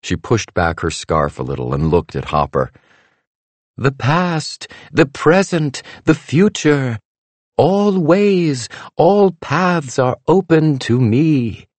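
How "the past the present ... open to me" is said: high, slow mystic voice; grand mystical pronouncement; fortune teller. A narrator's reading. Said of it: "She waved her hands over the crystal ball".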